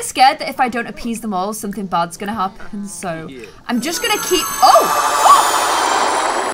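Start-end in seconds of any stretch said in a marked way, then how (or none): 4.6-6.5 startled oh. Real, not acted. Gnomes suddenly vanish.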